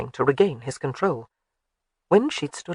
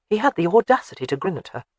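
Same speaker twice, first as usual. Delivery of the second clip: ordinary delivery, nothing marked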